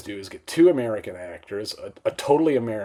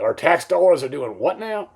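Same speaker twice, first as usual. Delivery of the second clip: mock outrage, pitched up and louder; irate journalist; 6 semitones higher, 5 dB louder than usual. Outraged journalists react to tax-funded exploitation movies, mock irate. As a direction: imitate an indignant, disbelieving question. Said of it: "get the journalists all irate".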